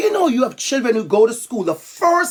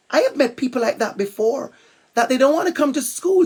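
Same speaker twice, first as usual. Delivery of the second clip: ordinary talk, nothing marked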